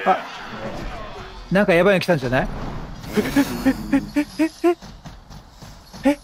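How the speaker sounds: normal talking voice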